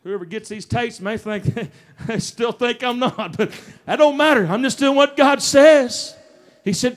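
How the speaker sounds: usual speaking voice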